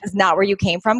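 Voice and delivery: ordinary talk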